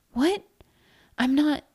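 high, startled protest; high-pitched shocked reaction. Acted. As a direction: start to protest, then break off. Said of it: "the woman started".

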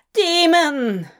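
sharp alarmed cry. A narrator's reading. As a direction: cry out accusingly. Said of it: "the woman cried behind him".

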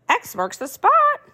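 high, slow parrot squawk. Acted. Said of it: "squawked the pirate's parrot".

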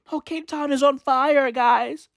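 ordinary talk

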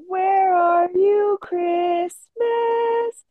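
put-on high, slow singsong little girl; drawn-out wistful child voice; Cindy Lou voice. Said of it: "I do love the".

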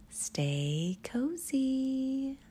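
very slow, drawn-out, rising tune; singsong goodnight farewell; cosy sign-off. Real, not acted. Host signing off to bedtime listeners, slow singsong.

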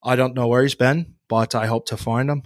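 put-on low, understated Irish accent; Jamie Dornan. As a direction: keep the Irish accent down and subtle. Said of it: "when you're Jamie Dornan and you're doing it right here".

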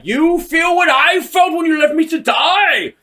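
high, loud, drawn-out mock villain shout; hammed-up furious accusation. Vader accusing the master who left him, mock furious shout. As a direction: throw the old betrayal back at him, hammed up.

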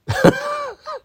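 short high-pitched giggle; a quick squeal of laughter; high squeaky laugh. Real, not acted. Laughs after confession.

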